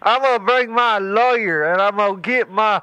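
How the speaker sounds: put-on a crotchety old man who stammers on every word. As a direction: stutter and sputter like an angry old hillbilly. Stuttering insults.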